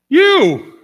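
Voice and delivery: sudden high shout of "You!"; shouted reveal. Real, not acted. Preacher to congregation, a shouted high "You!". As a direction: fling the answer out loud and high. Preacher's climactic answer to question.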